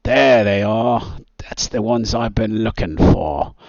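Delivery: put-on lightly butchered Australian accent with a grin; terrible Australian accent. Game master voices the grinning villain in a mock Australian accent. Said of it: "in a terrible Australian accent, which I will not butcher".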